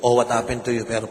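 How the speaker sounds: ordinary talk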